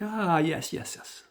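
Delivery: high then whispered yes yes; mock satisfied Hirsch; pleased old man. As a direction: savour it, then breathe the last yeses. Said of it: "He's like".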